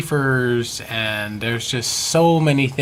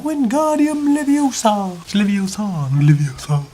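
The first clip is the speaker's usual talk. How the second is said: mock Hermione; 10 semitones higher, 4 dB louder than usual. Imagining Hogwarts life, prim schoolgirl impression. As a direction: correct the spell like a prim know-it-all. Quoting Harry Potter spell.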